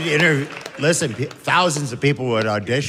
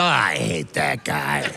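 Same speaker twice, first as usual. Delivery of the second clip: low, slow grumble of "I hate that guy"; deep, slow grouchy dad; dad grumbling; 9 semitones lower, slower than usual. Retold or imitated. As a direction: drop the voice way down and grumble. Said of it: "Somebody would come in and go".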